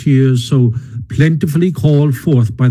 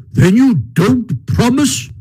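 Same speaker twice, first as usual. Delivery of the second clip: indignant challenge; 11 semitones higher, slower than usual. A narrator's reading. Badger challenges unrepentant Toad.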